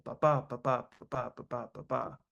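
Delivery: "ba, ba, ba" in a rigid weak-strong beat; alternating stress. Shown as a demonstration. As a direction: chant "ba" in even pairs, every second one punched. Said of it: "alternating stress syllable".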